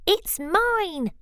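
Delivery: high, eager cry of mine; shouting. Acted. Cyril shouting at Bruce over the cone. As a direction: claim it sharply and high. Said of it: "shouted Cyril".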